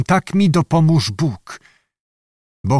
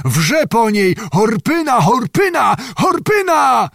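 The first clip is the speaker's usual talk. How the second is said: inhuman roar; 10 semitones higher than usual. A narrator's reading. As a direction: roar the name, climbing in despair. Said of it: "wyrwał się ryk prawie nieludzki". (ryk prawie nieludzki, coraz wyżej)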